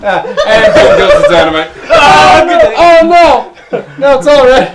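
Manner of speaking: high, loud laughing exclamations; real giggling fit. Real, not acted. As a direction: gasp out words through laughter.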